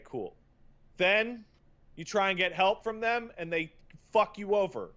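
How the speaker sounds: ordinary talk, nothing marked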